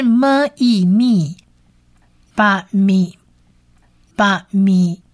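"么、 e、咪" sounded out as m, ee, mi; syllable blending. Shown as a demonstration. Teacher builds the word for learners, step-by-step pronunciation demo. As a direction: join "把咪" as two distinct syllables, ba-mi. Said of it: "这是一个短母音".

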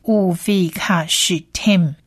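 乌菲卡许tem slowly, as "u-fi-kha-sh-tem"; 乌菲卡许tem slow and evenly separated; whole-word pronunciation demo. Shown as a demonstration. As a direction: model 乌菲卡许tem syllable by syllable. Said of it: "整体发起来会变成是".

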